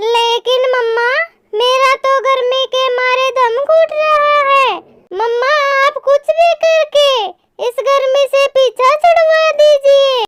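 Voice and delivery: high-pitched little chick whining to mummy; complaining baby bird. Acted. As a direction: play a young bird troubled by heat, pitched well up. (परेशान नन्हे पक्षी की ऊँची आवाज़)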